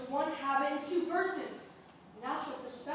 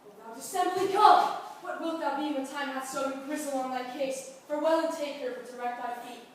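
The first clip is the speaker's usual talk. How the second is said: loud, angry scolding; furious rebuke; 9 dB louder than usual. Acted. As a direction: tear into the traitor. Duke furious at betrayal.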